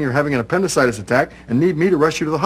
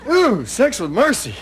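excited; 6 semitones higher than usual. Real, not acted. Growing glee over neighbor.

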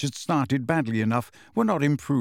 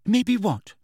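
high, curt snap; snappish; 8 semitones higher than usual. A narrator's reading. Hermione snapping at Ron, impatient and high. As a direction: bite the question off short. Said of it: "said Hermione rather snappishly".

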